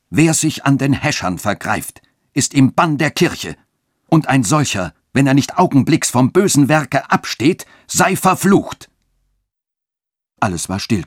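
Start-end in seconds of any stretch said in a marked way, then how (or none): 0.1-8.9 rain down a curse on the crowd; wrathful curse. A narrator's reading. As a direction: bishop cursing the rioters from a doorstep. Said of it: "rief in höchstem Zorne".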